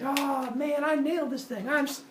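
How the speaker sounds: high-pitched gushing; so excited. Retold or imitated. Epimetheus after creating the platypus, so excited. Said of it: "Is so excited".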